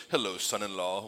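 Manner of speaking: a light imitation of Newman's smug sitcom hello; Seinfeld Newman voice. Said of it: "in her best Simon, uh, Seinfeld Newman voice".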